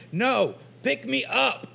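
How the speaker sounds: put-on bossy little girl. Little girl ordering Joe to pick her up, mock demand. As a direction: snap it like a pushy four-year-old. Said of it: "she snapped at me and goes".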